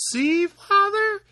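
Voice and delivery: very high, loud and slow; proud little Tom. Acted. Tom showing his father the cart, very high and proud. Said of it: "cried out".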